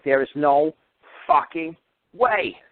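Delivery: slow, emphatic mock yell with little added volume; replaying an angry refusal, word by word; retold yelling. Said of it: "I was yelling at her".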